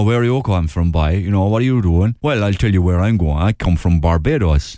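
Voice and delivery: Cork lilt turning Caribbean; Barbadian-Cork accent. Telling where he comes from, Barbadian-Cork accent. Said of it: "Because the Cork accent is".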